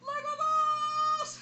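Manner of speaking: drawn-out high shriek. Real, not acted. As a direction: shriek it out slowly. Crying out at the prank.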